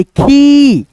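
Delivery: खी with a long, held "eee" vowel; drawn-out ई. Shown as a demonstration. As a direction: stretch खी out as "kheee". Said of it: "ई बोल रहे हैं दोस्तों खींच करके".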